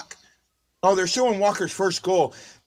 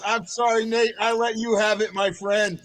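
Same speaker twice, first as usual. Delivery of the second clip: ordinary talk, nothing marked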